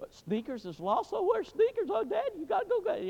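put-on high, loud pleading child voice; daughters pleading. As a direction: beg Dad like a worried little girl. Daughters begging for the dog.

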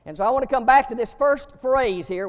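ordinary talk

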